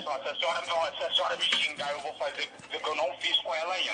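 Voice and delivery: menacing kidnapper. Acted. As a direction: play the kidnapper taking the phone. Kidnapper threatens mother.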